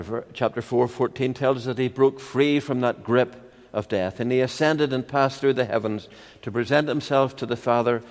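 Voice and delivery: ordinary delivery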